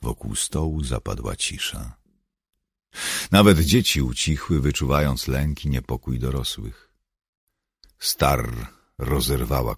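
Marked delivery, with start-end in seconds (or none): none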